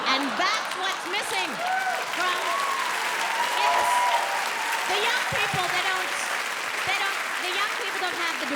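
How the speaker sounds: high, loud scolding; mock outraged shout. Scolding young crowd about self-loathing, loud. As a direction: bellow it at the youth.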